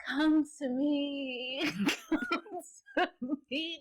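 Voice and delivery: high, very slow eerie call, broken by laughter; possessed woman beckoning. Retold or imitated. Retelling the possessed wife calling from the bed, eerie and slow. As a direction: drag out each word like a possessed woman luring him in, giggling through it.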